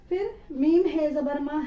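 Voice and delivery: ordinary delivery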